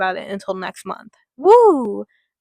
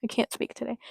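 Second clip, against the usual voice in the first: plain reading voice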